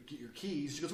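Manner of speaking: ordinary talk, nothing marked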